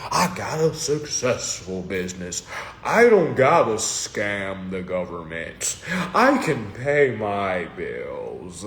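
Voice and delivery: low, slow mock of a self-satisfied earner; mock imitation of a smug critic. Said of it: "same tune that we already know".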